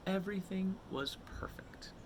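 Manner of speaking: soft breathy whisper; hushed storybook whisper. A narrator's reading. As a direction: whisper it like a secret. Storyteller hushes the happy moment.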